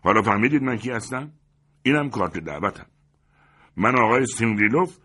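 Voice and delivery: loud, proud self-announcement; loud and self-important; indignant shout. A narrator's reading. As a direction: shout out who you are. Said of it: "سیمیون پانتلیچ فریاد زد".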